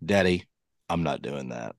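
low, quiet imitation of an unimpressed kid; deadpan refusal. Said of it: "looked at me dead in the eye".

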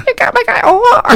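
echoing the line as Smelmo; squeaky high echo. Acted. Echoed much higher.

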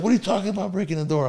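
high, fast baffled question; baffled friend. Retold or imitated. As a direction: play the clueless friend.

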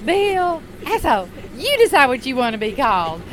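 high, loud sing-song dismissal; snippy Sheila. Retold or imitated. Sheila snapping at the engineer before walking off, high and loud. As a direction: chant the names high and snippy. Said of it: "She said".

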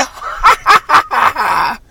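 loud, high leprechaun cackle; shrieking villain laugh; evil cackle. Acted. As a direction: cackle wildly like a wicked imp. Leprechaun cackles after his threat.

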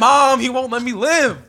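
put-on high, loud, slow whiny kid voice; hammed-up childish complaint; mock whine to mom. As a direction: play the kid tattling to mom. Whining to mom jokingly.

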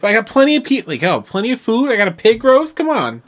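high, loud hammed-up dude voice; friendly stranger invite. Stranger inviting her to his pig roast, loud high dude voice. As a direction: pitch up into an eager bro inviting you over. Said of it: "And he's like".

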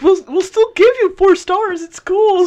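reassuring the kidnapped driver, bright and loud; mock reassurance. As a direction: pitch it up and sell it brightly. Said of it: "I'm telling the guy".

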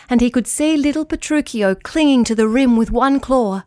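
usual speaking voice

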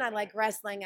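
ordinary talk, nothing marked

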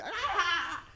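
high-pitched squeal of laughter; dying of laughter. Retold or imitated. Imitating baby cousin watching a clip, high hysterical laugh. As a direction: imitate a little kid cracking up helplessly. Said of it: "he's dying on the couch".

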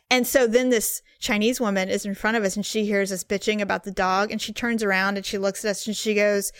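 ordinary delivery

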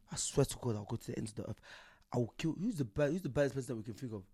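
ordinary delivery

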